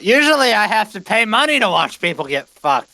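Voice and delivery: calling out. Acted. Caravan guest heckling the fight from the sidelines, calling out. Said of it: "calls out".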